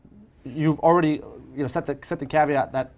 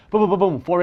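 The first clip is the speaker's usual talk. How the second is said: brisk, punchy boom boom boom; quick showy booms; 5 semitones higher, 6 dB louder than usual. Retold or imitated. Magician rattling off a trick, punchy and higher. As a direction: snap through the reveal with flair.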